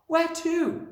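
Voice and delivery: put-on high, loud child's question; startled little girl, pitched right up; crying out. A narrator's reading. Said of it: "Matilda cried out".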